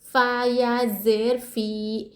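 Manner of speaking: very slow, फ़ी held one alif length; teacher models the madd on फ़ी; stretched फ़ी. Shown as a demonstration. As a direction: draw out फ़ी for one count. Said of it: "एक अलिफ की मकदार फ़ी को खींचेंगे".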